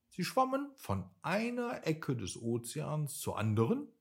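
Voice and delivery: plain reading voice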